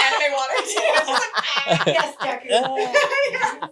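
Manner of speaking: loud, high laughing jab; mock the anime tears while laughing; laughing tease. Real, not acted. Friend mocking the crier's anime tears, laughing loudly.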